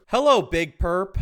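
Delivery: slow, loud, mock-adoring greeting on request; hammed-up greeting. Shown as a demonstration. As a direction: belt out "Hello, Big Perp." like a starstruck fan. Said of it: "Can you do the same thing but for Big Perp?".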